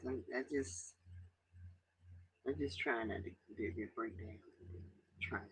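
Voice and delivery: fighting tears. Real, not acted. Telling her viewers she won't break down, voice failing into tears. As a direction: swallow the tears as the voice gives out. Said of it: "trying not to cry".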